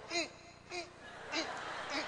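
stifled hums. Retold or imitated. Boy refusing to cry.

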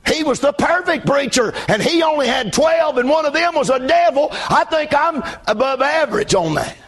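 preaching. Real, not acted. Pastor joking to his congregation about his flock, preaching.

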